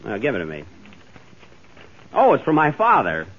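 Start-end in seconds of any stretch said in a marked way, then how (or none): none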